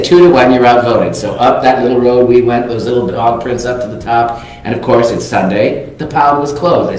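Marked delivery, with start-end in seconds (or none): 0.0-1.1 loud, triumphant two-to-one; gleeful outvoting. Retold or imitated. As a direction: crow it like the winners of the vote. Friends overrule him, teasing.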